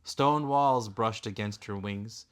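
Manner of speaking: plain reading voice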